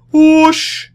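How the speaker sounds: a loud, high whooshing swoosh; whoosh sound effect. Retold or imitated. Fan in the Nylark shirt heading off, loud high whoosh. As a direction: blast a whoosh like something zooming off. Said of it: "I would go".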